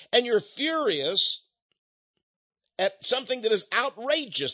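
high, slow, emphatic outrage; righteous anger, each phrase drawn out high; real indignation. Real, not acted. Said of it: "I'm really mad".

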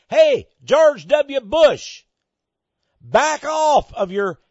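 loud, high, drawn-out mock demand; acted defiant call-out. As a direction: call him out as if across a hall.

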